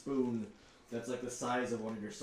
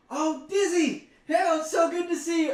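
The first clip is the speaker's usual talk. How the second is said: high, loud gushing mother; excited mom voice; 8 dB louder than usual. Acted. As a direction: greet like an overjoyed mom. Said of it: "she's like".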